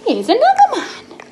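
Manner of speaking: high, loud surprised reveal; excited announcement. Acted. Mum reading to son, high surprised announcement. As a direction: pop the new man in with a squeal. Dramatic new character.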